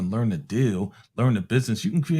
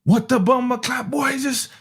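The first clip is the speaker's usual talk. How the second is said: put-on Caribbean accent; 12 semitones higher than usual. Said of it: "he got a Caribbean accent".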